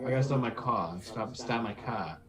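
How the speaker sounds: put-on Boston accent with dropped R's; mock a Boston accent, vowels strung out through the nose; exaggerated Boston imitation. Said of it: "talking a mocking Boston accent".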